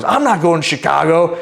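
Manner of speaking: high-pitched mock indignant denial; imitating irate woman. Woman answering man's Chicago question, mock indignant. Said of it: "And she goes".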